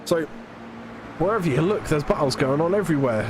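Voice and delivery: ordinary talk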